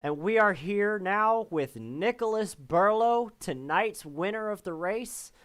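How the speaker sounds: stretched-out, lifted broadcast intro; announcer introduction. Real, not acted. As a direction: present the winner like a sports announcer, drawing out the words. Host introduces the race winner.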